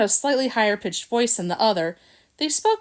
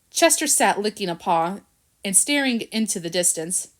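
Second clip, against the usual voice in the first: plain reading voice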